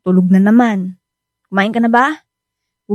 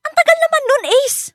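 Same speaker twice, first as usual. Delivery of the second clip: can't believe how long he wants to be away; shocked disbelief; 11 semitones higher than usual. Acted. Wife balks at the three-day trip, shocked and incredulous.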